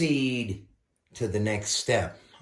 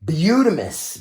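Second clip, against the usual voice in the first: excited exclamation; 5 semitones higher, 8 dB louder than usual. Real, not acted. Admiring finished paint job.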